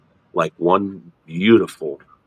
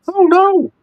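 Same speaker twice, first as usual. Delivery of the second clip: high falsetto, louder; comic emphatic denial; 5 dB louder than usual. Acted. Speaker admits not understanding the body, in comic high falsetto. Comic answer to own question.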